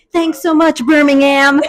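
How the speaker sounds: mock stage shout from an American musician; American band onstage. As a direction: imitate a touring band thanking the crowd. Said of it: "an American band comes through and they're like".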